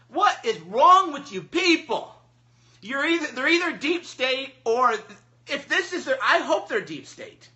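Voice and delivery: loud, high, incredulous ranting; exasperated rant. Real, not acted. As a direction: berate them in disbelief. Exasperated rant at the alt-right.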